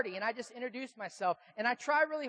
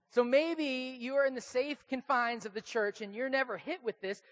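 normal talking voice

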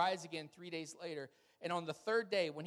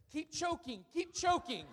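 nervous, much higher "Keep choking" with a laugh breaking in; nervous blurt; 10 semitones higher than usual. Retold or imitated. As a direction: blurt the wrong word in a high, nervous voice. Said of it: "and he says".

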